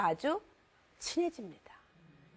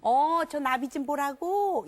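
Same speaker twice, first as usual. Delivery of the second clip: slow, patient parent voice; slow clarifying parent; 3 semitones higher, 5 dB louder, much slower than usual. Shown as a demonstration. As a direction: spell it out slowly for the child. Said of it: "명료화 시켜주는 거예요".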